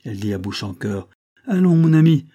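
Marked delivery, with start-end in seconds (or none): 1.5-2.3 high, loud, urging female character; intervening wife. Acted. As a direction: call him away, pitched well up. Wife intervenes to lead husband away.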